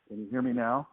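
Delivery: ordinary talk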